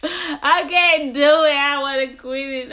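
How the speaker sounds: hammed-up fake sobbing, high and loud, breaking into a laugh; fake crying. Retelling her fast, mock-crying that she wants to quit. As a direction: wail it like a mock tantrum, laughing through it. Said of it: "fake crying".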